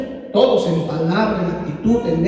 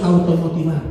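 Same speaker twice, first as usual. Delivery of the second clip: normal talking voice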